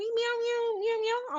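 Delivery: high meowing like a kitten; cat meows. Retold or imitated. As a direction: meow like a little girl playing cat. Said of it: "She goes".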